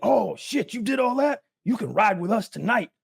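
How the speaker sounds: playing a tough inmate inviting him; gang member voice. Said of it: "they could be like".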